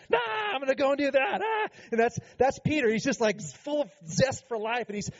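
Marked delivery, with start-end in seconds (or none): 0.1-1.7 flighty brush-off. Retold or imitated. Erratic person abandoning a plan, with a carefree shout. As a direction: toss it off like someone dropping one passion for the next. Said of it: "you're like".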